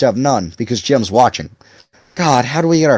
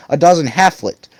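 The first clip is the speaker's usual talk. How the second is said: usual speaking voice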